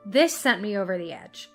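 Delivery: ordinary delivery, nothing marked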